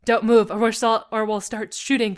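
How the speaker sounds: loud opening command, easing off; threatening. A narrator's reading. Robber to the SWAT member, threatening. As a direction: threaten them firmly. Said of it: "the man threatened again".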